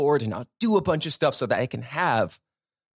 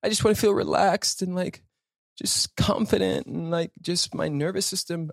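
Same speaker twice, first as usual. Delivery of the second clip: wishful sighing voice; 8 semitones higher than usual. Retold or imitated. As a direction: sigh it out like craving rest. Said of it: "So that you can feel what?".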